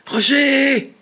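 shrieked high and loud; desperate shout. Acted. Trapped in the coffin, Richard screams for his friend Roger. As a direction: scream for rescue. Trapped man calls for help.

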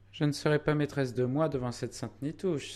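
high, contained outburst without volume; high-pitched, mildly acted indignation; indignant exclamation. A narrator's reading. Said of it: "s'écria Zélie".

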